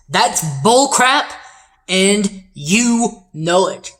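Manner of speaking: hammed-up angry retort, drawn out; acted indignation. Arguing back over Versailles, mock indignant.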